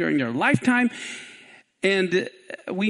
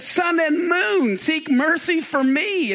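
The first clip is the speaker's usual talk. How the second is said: shouted plea; 8 semitones higher, 3 dB louder, slower than usual. Acted. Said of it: "So he said".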